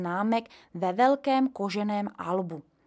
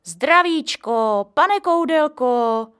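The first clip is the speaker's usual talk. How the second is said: hearty greeting; 6 semitones higher, 9 dB louder, much slower than usual. A narrator's reading. Caretaker greets grandpa.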